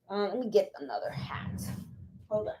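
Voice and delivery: softly whispered to herself; quiet whispered note to self; whispered aside. Real, not acted. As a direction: murmur it off to the side while reaching for something. Aside fetching another hat.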